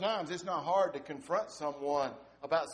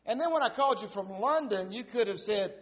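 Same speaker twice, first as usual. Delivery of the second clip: much higher, louder scolding wife voice; the upset wife; 9 semitones higher, 5 dB louder than usual. Retold or imitated. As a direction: scold him in a high, exasperated wife's voice. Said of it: "She said".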